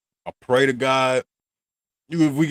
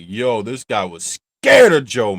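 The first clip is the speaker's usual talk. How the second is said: slow, louder, stunned realization; shocked exclaim; 7 dB louder, slower than usual. Retold or imitated. Reacting to Michael's fear of his father, slow loud exclaim. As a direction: call it out slowly like it just clicked. Retelling own reaction to Joe.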